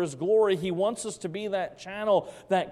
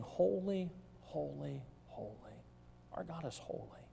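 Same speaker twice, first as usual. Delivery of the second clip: much softer, falling to a near whisper; hushed holy holy holy; 13 dB quieter than usual. Acted. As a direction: breathe it out in hushed awe. Quiet reverent quoting of worship.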